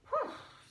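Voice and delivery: high relieved puff of air; real winded ouf; breathless phew. Real, not acted. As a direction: blow out after the singing. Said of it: "je suis défoulée".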